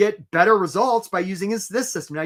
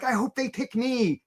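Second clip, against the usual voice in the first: a hint of a hopeful job seeker, slower; lightly voiced timid hope; meek hope; 5 semitones higher, slower than usual. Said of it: "of like".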